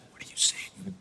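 barely voiced, almost mouthed; drowned out. Dad answers son over the blower, drowned out. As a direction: mimic words lost under machine noise, nearly silent.

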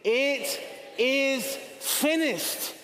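slow, drawn-out proclamation, each word weighted; triumphant slow declaration of victory; declared with conviction. Shown as a demonstration. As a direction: say it like you believe it. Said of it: "Say it like you believe it".

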